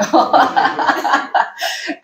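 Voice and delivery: short airy laugh at herself; breathy laugh. Real, not acted. Laughing while telling story.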